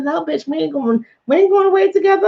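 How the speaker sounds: high-pitched, exasperated no; unspoken indignant refusal. Retold or imitated. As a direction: shoot the idea down as if in your head. Acting out her unspoken refusal.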